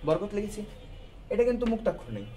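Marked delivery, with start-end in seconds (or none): none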